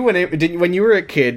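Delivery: usual speaking voice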